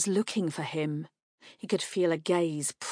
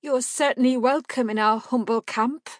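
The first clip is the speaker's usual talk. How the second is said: nervous; 6 semitones higher, 5 dB louder than usual. A narrator's reading. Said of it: "she found her voice with a little gulp".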